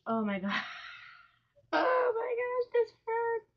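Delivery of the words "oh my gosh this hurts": high, slow pained cry; anguished. Real, not acted. Painting over the doll's eyes, crying out in pain. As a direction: wail it like it physically hurts.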